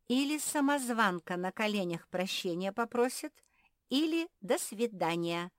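imperious, brooking no argument; cutting ultimatum. A narrator's reading. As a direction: lay down terms with offended finality. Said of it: "отрезала Квакушка".

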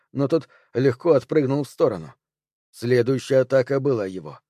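ordinary delivery, nothing marked

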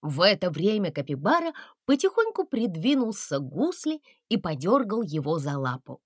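plain reading voice

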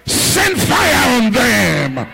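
roaring command at full force; fire prayer shout. Real, not acted. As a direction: call down fire like a battle cry.

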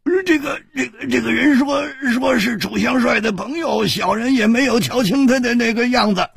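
high, strained, whining thug voice; a scared big man begging for mercy; pained lackey. A narrator's reading. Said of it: "这个大汉苦着脸说".

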